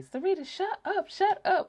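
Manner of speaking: high, laughing shut up; sister laughing. Retold or imitated. Sister at the sink, laughing high and loud. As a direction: giggle it out, unable to stop. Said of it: "She was telling me".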